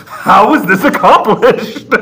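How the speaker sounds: hammed-up awe at the clock; amazed inventor. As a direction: Maurice marvelling at the clock, hammed-up. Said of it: "he says".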